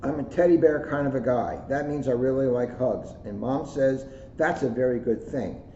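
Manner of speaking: put-on bright, lively storybook read in a child's voice; reading to children. A narrator's reading. Officer reading a picture book to schoolkids, lively storybook voice. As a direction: read it like a proud kid telling his story. Reads kids' book aloud.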